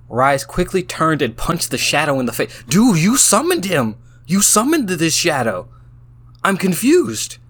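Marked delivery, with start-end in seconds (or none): 2.7-7.4 loud, high, incredulous exclamation; baffled outburst. Real, not acted. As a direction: exclaim in real disbelief. Baffled by the game's turn.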